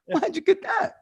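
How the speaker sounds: squeaky high, mock baffled; puzzled. Retelling his own reaction at the market, hammed-up high puzzlement. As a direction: act baffled, voice jumping way up. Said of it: "I'm like".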